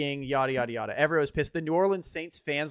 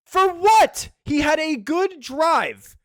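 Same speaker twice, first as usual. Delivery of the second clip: much higher, louder incredulous retort; indignant scoff; 10 semitones higher, 7 dB louder, much slower than usual. Real, not acted. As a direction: fire it back, mocking the question, slowly. Scoffing at demand for praise.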